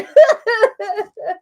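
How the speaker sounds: loud, high squealing laugh. Real, not acted. Crafter laughing at the rough situation, high laugh. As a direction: crack up at herself. Laughing at her messy desk.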